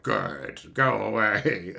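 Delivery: low, curt voice of a bored overseer; dismissive overseer. Retold or imitated. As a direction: brush him off from deep down. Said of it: "the overseer's like".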